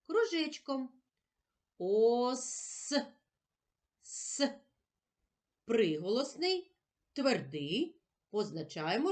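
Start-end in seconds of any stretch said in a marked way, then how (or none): 4.0-4.6 С hissed on its own, no voice; a soft voiceless С hiss; sound the consonant alone. Shown as a demonstration. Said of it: "назвемо всі звуки". (тихе шипляче С без голосу)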